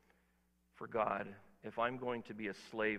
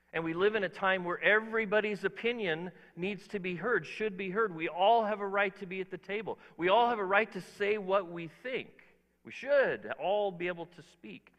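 raised, louder preaching voice building up; preaching at full force; 7 semitones higher, 5 dB louder than usual. Acted. Pastor preaching to the congregation at full force. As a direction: proclaim it to the whole room.

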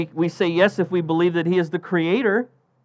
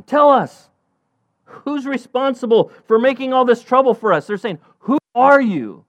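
higher, louder sailors firing questions; frantic questioning; 8 semitones higher, 4 dB louder than usual. Acted. Frightened sailors demanding answers from Jonah, high and loud. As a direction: fire questions at him in a panic.

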